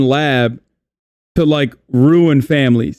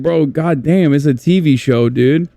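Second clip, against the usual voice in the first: ordinary talk, nothing marked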